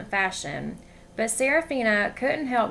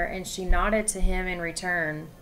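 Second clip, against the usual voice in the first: ordinary delivery, nothing marked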